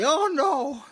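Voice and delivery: high, loud hammed-up panicked wail; mock panic. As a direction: squeal it out like a frightened brother, overdone. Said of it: "he didn't say".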